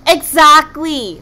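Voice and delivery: slow, loud self-satisfied agreement; proud smile. Acted. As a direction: beam with pride at dying first. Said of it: "with a proud smile".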